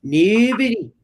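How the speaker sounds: high, hammed-up Scottish retort; Scottish accent. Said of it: "not as bad as the Scottish accent".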